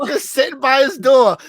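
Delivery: laughing. Real, not acted. Picturing the man waiting at his door, laughing. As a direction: crack up as you say it.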